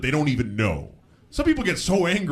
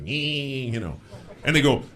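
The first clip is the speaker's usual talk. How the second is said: ordinary talk, nothing marked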